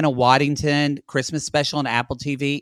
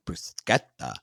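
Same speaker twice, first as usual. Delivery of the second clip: much lower, scoffing Bruschetta; mocking question; 9 semitones lower, 4 dB quieter, slower than usual. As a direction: question the word with disdain. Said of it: "And she went".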